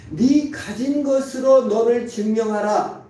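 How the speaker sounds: lightly voiced Satan laying down his demand; a hint of a commanding tempter; Satan's voice. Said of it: "이게 사탄의 거짓말입니다".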